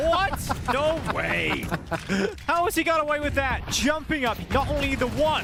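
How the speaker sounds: high, amazed play-by-play call; excited disbelief. Real, not acted. Caster reacting to wild play.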